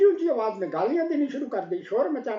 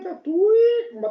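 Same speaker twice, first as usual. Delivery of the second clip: triumphant; 9 semitones higher, 7 dB louder, much slower than usual. A narrator's reading. Wife triumphantly mocks husband.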